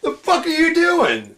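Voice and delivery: high, slow, laughing yell. Retold or imitated. Repeating the line to the Batman guy, laughing. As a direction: drawl it out laughing.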